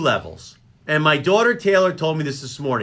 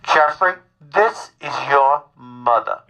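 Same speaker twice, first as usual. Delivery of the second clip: mother impression; 3 semitones lower than usual. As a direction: imitate a mother spelling out each word. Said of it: "And she's like".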